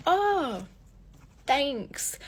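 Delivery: high-pitched, imitated uneasy thanks; awkward politeness. Woman answering a stranger's unwanted compliment, imitated awkward politeness.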